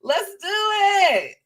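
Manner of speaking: high, loud, slow cheer for her; root for her like a fan in the stands; drawn-out excited cheer. Retold or imitated. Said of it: "I'm just like".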